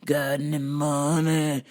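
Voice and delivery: low, slow zombie drone. Acted. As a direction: groan it out like the undead, slowly. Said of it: "coming out of the bushes like they were zombies".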